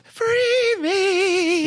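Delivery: put-on high, eerie child voice; creepy little kid pleading. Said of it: "creepy little kid voice".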